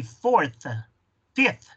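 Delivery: "fourth" and "fifth" ending in clear th; careful teacher's model, th blown closing "fourth", "fifth"; blown final th. Shown as a demonstration. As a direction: let the th ring out in "fifth". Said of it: "vas a soplar".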